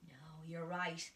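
ordinary talk, nothing marked

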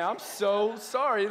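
high, loud, drawn-out sorry; hammed-up apology, leaning on the words; mock apology.

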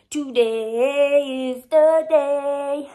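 dragged out, loud and high; very slow. Shown as a demonstration. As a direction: stretch every word out slowly. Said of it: "drawn-out third take".

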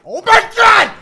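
high, loud shocked cry; startled shout. Real, not acted. Player jumped by horror game, startled shout. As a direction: yelp at a sudden fright.

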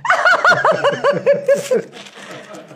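very high, loud cackle; long shrieking laugh. Real, not acted. Laughs at her confession.

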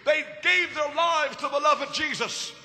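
high, rhythmic pulpit litany; impassioned preaching. Real, not acted. Preacher honoring what the beloved leaders gave, impassioned preaching.

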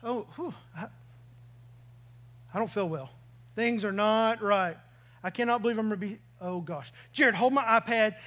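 half-mocking nauseous voice, fading weak; airsick groan. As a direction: act out feeling sick on a bumpy flight. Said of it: "all of a sudden I go".

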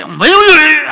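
high, blaring mock tongues; loud prayer. Boy praying in tongues beside his teacher, very loud. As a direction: blast out the babbled prayer. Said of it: "그냥 크게".

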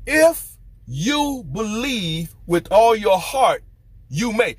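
slow, drawn-out, weighty reading of the verse; slow scripture reading. Acted. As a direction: lean on every word of the promise. Preacher reading Philip's scripture words.

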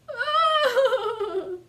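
high loud cub bawl; loud wailing sob. Acted. Baby Bear bursting into tears, loud bawl.

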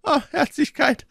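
high, wailing groan. A narrator's reading. Innkeeper hears of the emptied bottles, groaning. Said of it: "ächzte der Wirt".

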